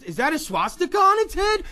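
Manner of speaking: high, loud disbelief; hammed-up double take; mock shocked. Shocked neighbor finds dog.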